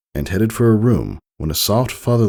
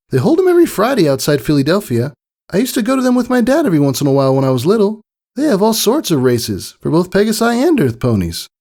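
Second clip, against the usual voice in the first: high filly voice, rattling on fast; an excited kid explaining everything at once; quick, eager young filly; Scootaloo; 5 dB louder, faster than usual. Acted.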